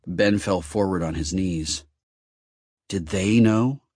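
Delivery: plain reading voice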